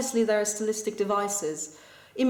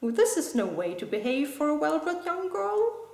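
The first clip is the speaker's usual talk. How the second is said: high-pitched scolding mum, pitch climbing to the end; mock nagging, rising ever higher; nagging, moralizing mother; 6 semitones higher than usual. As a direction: lecture her like an indignant mother. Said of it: "her mother's nagging moralizing".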